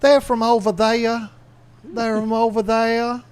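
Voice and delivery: put-on high, drawn-out Northern Neck Southern accent. Imitating the locals of Virginia's Northern Neck, high Southern accent. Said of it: "that accent is very different".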